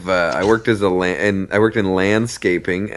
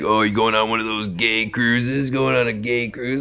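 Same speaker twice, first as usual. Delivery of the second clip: teasing coworker; 3 semitones higher, 3 dB quieter than usual. Retold or imitated. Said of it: "He's like".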